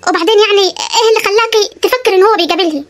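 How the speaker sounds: ordinary talk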